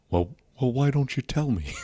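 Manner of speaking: halting comeback in a put-on voice; Jesse Ventura. Comic repeating his impression for laughs, lightly voiced. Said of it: "the whole Jesse Ventura thing".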